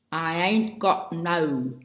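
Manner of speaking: lightly voiced, slow folksy country talk; backwoods country speech. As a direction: quote the farm folk in a slow, homespun way. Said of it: "their speech is clotted with grammatical inventions".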